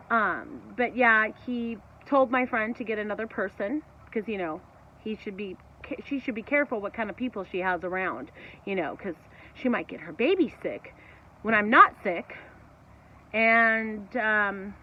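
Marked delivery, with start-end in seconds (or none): none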